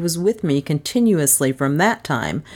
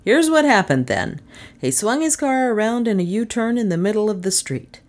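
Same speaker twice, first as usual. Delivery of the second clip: lightly voiced sleuth, higher pitched; raised-pitch detective laying out events; explaining detective; 7 semitones higher than usual. A narrator's reading. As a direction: reconstruct the crime for the lawman.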